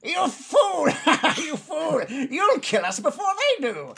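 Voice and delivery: high-pitched shouting goblin; angry goblin. Acted. Goblin scolds the friend who shot his ally, shrill outrage. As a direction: screech it in a high, loud goblin voice. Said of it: "the other goblin sees this".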